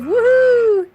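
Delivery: loud, high whoop of joy; excited cheer. Real, not acted. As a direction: cheer for being live at last. Excited about going live.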